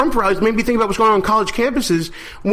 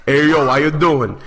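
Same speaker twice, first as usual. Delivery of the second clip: lightly voiced boxer impression, lower pitch; Rocky; 5 semitones lower than usual. Co-host teasing his colleague's accent, lightly voiced Rocky impression. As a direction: put on a hint of a movie boxer's greeting. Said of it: "like a more intelligent Rocky".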